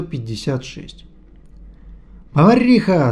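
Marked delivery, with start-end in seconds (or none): 2.3-3.1 loud angry call from the doorway; yelled. A narrator's reading. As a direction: bellow the word across the room. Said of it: "с порога заорал он".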